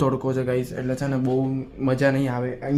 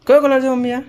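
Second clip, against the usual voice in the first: excited question; 10 semitones higher, 6 dB louder than usual. Real, not acted. Showing mom's dress.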